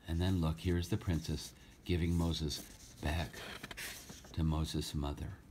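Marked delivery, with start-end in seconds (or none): none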